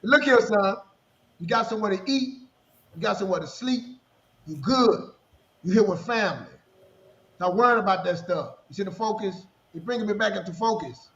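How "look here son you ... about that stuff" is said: firm fatherly voice. Retold or imitated. Father comforting son.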